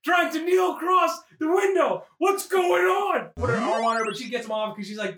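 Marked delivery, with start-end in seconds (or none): 0.0-3.3 much higher, slow, shouted alarm; a neighbor shouting about a man at the window; mock alarmed neighbor. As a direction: gape at the giant in the window.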